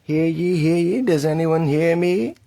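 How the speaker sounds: loud, high town crier proclamation; grand rich voice. Acted. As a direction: announce it like a herald. Proclamation over music.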